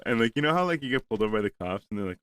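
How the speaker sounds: ordinary talk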